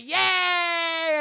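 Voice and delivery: high, loud drawn-out cheer; mock crowd cheer. Storyteller voicing the crowd at the cliff, loud mock cheer. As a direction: imitate a delighted crowd at full voice. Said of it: "Everyone's like".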